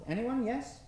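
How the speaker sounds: ordinary talk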